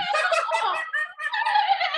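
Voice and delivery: high loud laugh; real laugh. Real, not acted. Cracking up after the monkey noises, real high laughter. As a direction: laugh at your own monkey.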